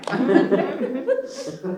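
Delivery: loud nervous laughter; long, uneasy burst of giggles; awkward laugh. Acted. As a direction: laugh off an awful admission. Admits pretending to hit him.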